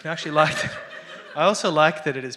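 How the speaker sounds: laughing through the words; loud amused laugh while talking. Real, not acted. Joking about Illustrator's PDF blobs.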